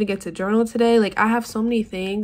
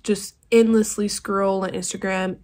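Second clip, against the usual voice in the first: usual speaking voice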